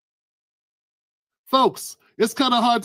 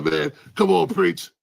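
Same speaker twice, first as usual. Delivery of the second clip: a lightly voiced old man from the block, lower; Crab's voice; 3 semitones lower than usual. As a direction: lower your voice a bit like an old-timer giving advice. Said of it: "'Cause he talk like this".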